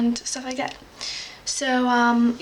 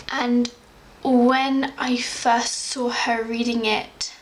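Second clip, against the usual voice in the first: ordinary talk, nothing marked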